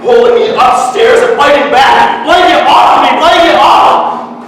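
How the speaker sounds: high, loud struggling shout; frantic shouting. Acted. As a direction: shout while fighting to be let go. Boy dragged upstairs by mother.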